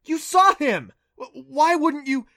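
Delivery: loud, high shout, stumbling on why; a boy shouting his point at a doubter; yelling. A narrator's reading. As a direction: keep yelling, frustrated. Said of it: "he yelled".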